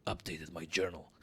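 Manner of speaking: low, soft game-announcer echo; mimicked game notice. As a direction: parrot the game's notice under your voice. Mimics the game's journal notice.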